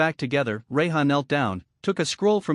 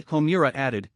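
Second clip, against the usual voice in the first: usual speaking voice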